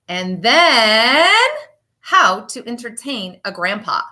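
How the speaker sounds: lively, sing-song picture book reading; reading to children. Acted. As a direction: read it like storytime for little kids. Teacher reads picture book aloud.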